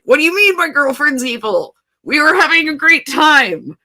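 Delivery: indignant protest. Retold or imitated. Pike protests about girlfriend.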